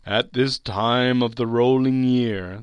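a slow, mournful ghostly lament; suffering ghost. Acted. Ghost speaks in the story.

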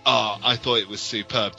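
ordinary talk, nothing marked

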